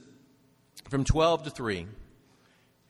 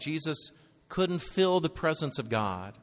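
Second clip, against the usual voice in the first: normal talking voice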